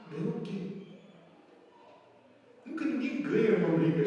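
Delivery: ordinary talk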